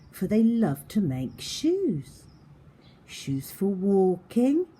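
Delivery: lively read-aloud for little ones; expressive picture-book reading; story narration. A narrator's reading. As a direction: paint the scene for young listeners. Reads story to children.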